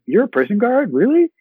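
rising surprised disbelief; mock amazement. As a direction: act amazed someone's a prison guard. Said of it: "I'm like".

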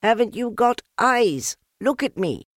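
high loud frustrated shout; shout, desperate to be seen. A narrator's reading. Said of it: "shouted Piglet".

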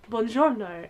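low, understated Italian greeting; Italian waiter greeting. Retold or imitated. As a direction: softly imitate a waiter's buongiorno. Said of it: "Waiter comes over and he's like".

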